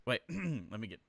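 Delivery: ordinary delivery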